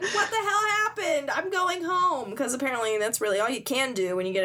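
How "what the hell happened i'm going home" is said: high-pitched baffled complaint, slowing at the end; high, hammed-up dismay; mock bewilderment. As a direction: act out a stunned, fed-up character. Bewildered woman acted out.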